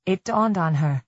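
ordinary delivery, nothing marked

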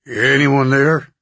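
slow, unsure drawling voice; deep tentative drawl. A narrator's reading. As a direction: drawl it out, feeling your way. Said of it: "a deep, drawling voice ask tentatively".

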